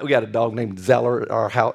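ordinary talk

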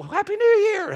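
high excited New Year call; mock party cheer. Pastor mimicking teens at the midnight party, cheering high.

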